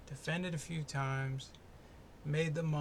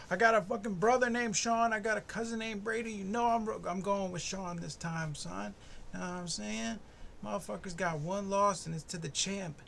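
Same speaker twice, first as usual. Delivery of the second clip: excited; 5 semitones higher, 4 dB louder than usual. Real, not acted. Excited hype rant.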